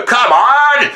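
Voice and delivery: high exasperated dog yelp; a fed-up dog's protest; annoyed dog voice. Retold or imitated. Dog protesting at being asked for tricks, high and exasperated. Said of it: "he'll then go".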